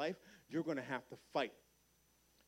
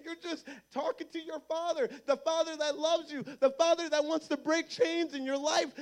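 higher and louder, driving the call to prayer; full-force preaching; 9 semitones higher, 7 dB louder than usual. Real, not acted. Preacher urging the youth to get on their knees and pray, at full force. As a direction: preach it hard and urgent.

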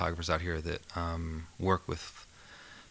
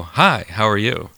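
slow, louder chirpy greeting at the camera; awkward client greeting; 2 semitones higher, 9 dB louder, slower than usual. Retold or imitated. Photographer mimics posing client's slow cheery hello. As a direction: mimic a stiff client turning to camera, drawn-out. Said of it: "he'd turn towards the camera and he'd go".

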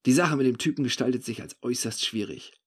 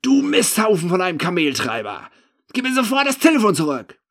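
high, full-force angry yell; furious outburst; 8 semitones higher, 10 dB louder than usual. A narrator's reading. Drunk narrator exploding at the suspected thief, furious outburst. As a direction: explode with rage and demand it back. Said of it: "Dann platzt es aus mir heraus".

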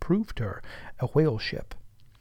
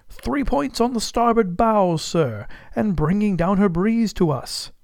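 high, loud answer from aloft; lookout calling down; 8 semitones higher, 4 dB louder than usual. Acted. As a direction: sing it out from the masthead.

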